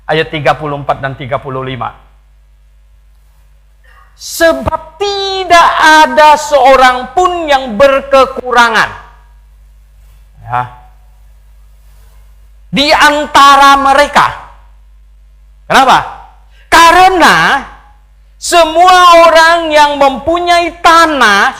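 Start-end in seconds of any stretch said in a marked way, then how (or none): 4.2-8.9 loud, high, drawn-out proclamation; declaimed scripture reading. Acted. Preacher reads Acts to the congregation, loud declaimed scripture. 12.7-21.6 loud, high, drawn-out proclamation; declaimed scripture reading. Acted. Preacher reads Acts to the congregation, loud declaimed scripture.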